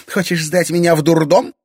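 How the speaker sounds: high, mocking question; unafraid challenge. A narrator's reading. Irina daring father to commit her, unafraid challenge. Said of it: "Ничуть не испугалась Ирина".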